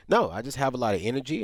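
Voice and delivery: ordinary talk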